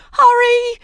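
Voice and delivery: very high, loud panicked wail. A narrator's reading. Ramona begging for rescue, high wail. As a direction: wail urgently, stretching the word. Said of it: "she wailed".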